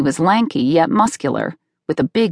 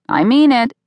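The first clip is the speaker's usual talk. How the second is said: higher, rising attempt at firmness; mock-tough mom; 7 semitones higher, 3 dB louder than usual. A narrator's reading. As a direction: insist like a mom nobody fears. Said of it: "every so often I got tough".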